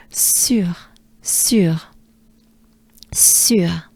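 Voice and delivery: sur with rounded U and breathed r; French sur. Shown as a demonstration. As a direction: separate sur, trailing into a throat r. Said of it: "is a French breath".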